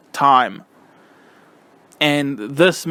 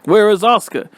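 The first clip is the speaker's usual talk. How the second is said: mock confused call; 6 semitones higher, 3 dB louder than usual. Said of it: "women's wrestlers were like".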